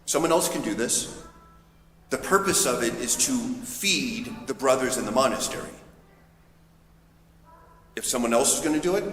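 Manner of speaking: normal talking voice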